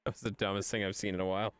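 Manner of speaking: normal talking voice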